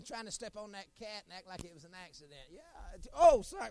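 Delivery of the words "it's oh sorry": loud hammed-up apology, higher; fake-sorry yelp to the crowd; playing innocent, loud and high; mock sorry. Mock apology after hurting cat.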